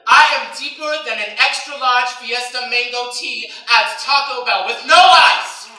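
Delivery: proud, loud punchline; building punchline. Real, not acted. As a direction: drive home the punchline. Punchline built up.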